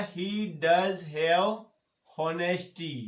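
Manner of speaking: very slow, word by word; slow dictation. Shown as a demonstration. Teacher dictating to class, very slow and deliberate. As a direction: spell it out slowly for students copying.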